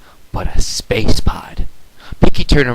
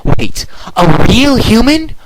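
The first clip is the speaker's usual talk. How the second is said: amazed question; 13 semitones higher, 6 dB louder, slower than usual. Acted. Twilight amazed.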